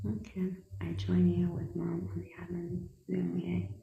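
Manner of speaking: soft whisper, nearly voiceless. Real, not acted. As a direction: read the comment under your breath. Reading a viewer comment aside.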